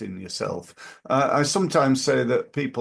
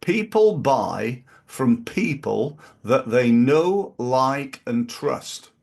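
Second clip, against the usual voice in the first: people buy from people that they know, like, and trust, said slowly with a pause before and trust; slow emphatic maxim; slower than usual. Real, not acted. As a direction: spell out the key rule slowly. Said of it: "Remember this".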